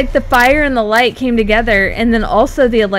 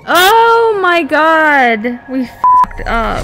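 dismayed outburst; 5 semitones higher, 4 dB louder, much slower than usual. Real, not acted. Realizes the puzzle step failed.

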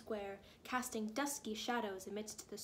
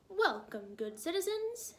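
lightly voiced booming mayor; a hint of a public welcome, pitch lifting; kind, booming mayor; 7 semitones higher than usual. A narrator's reading. Snowman mayor greeting his citizens, kindly booming. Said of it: "with a kind but booming voice".

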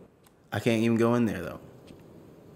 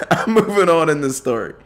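laughing through the words, higher pitched; 5 semitones higher, 4 dB louder than usual. Real, not acted. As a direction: break into a laugh while insisting on moving on. Laughs off a joke he withheld.

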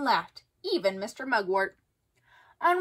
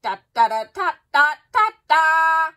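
loud, sung bugle fanfare; bugle call; 5 semitones higher, 9 dB louder than usual. A narrator's reading. Bugle waking the campers, loud and brassy. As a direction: trumpet it out like a bugle. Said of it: "a bugle blasted".

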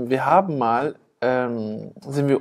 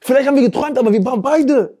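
excited; 11 semitones higher, 4 dB louder than usual. Real, not acted. Excited retelling of spooky night.

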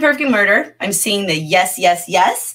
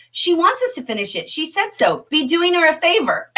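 higher-pitched, lightly acted officer; lightly voiced police officer; 8 semitones higher than usual. Officer urges the other detective to finish the lamb, lightly voiced. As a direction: read it up high as one detective to another. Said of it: "police officer says to the other detective".

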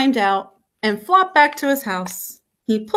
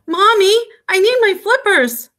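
put-on high, loud childlike call to Mommy; urgent plea; 9 semitones higher, 5 dB louder than usual. A narrator's reading. Froggy almost sinking calls mother, urgent high plea.

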